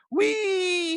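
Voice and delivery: high, drawn-out "wee" squeal; retold gleeful squeal. Co-host voicing Darkness's delight at the ball hit, gleeful squeal. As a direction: imitate her squealing with glee on one held high note. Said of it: "And she's like".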